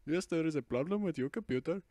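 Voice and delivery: a hint of an Indian call-centre voice; lightly voiced Indian scam caller; Indian accent. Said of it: "making an Indian accent".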